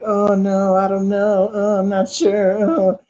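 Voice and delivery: a hint of a shy, unsure young woman; coy girl voice. Man mimics the hesitant girl he brought home, coy and unsure. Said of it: "sweet talked her".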